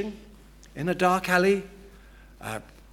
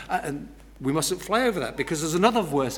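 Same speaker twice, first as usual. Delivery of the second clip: ordinary talk, nothing marked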